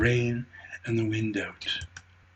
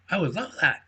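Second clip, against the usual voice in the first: squeaky squirrel; 6 semitones higher, faster than usual. Acted. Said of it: "said Squeaky".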